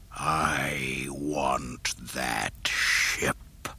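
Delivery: very slow, low, soft menacing hiss; Vader hiss. A narrator's reading. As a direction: hiss it like a dark lord. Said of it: "the Dark Lord hissed".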